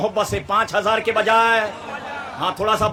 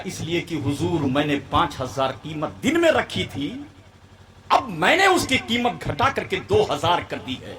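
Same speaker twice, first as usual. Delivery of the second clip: lowered voice of a modest petitioner; humble seller explaining, acted; 5 semitones lower than usual. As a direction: explain meekly to the master.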